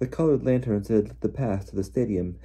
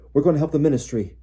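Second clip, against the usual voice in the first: raised, higher and hurried shout; shouting over noise; 6 semitones higher, 8 dB louder, faster than usual. A narrator's reading. Father to his family amid the panic, shouting. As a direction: call out urgently over the din. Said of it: "Mister Weasley shouted over all the noise".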